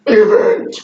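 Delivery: growled monster voice, raw and distorted. Shown as a demonstration. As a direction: roar the word like a monster. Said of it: "Now say it in a monster voice".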